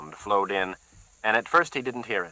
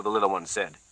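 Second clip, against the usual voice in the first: ordinary delivery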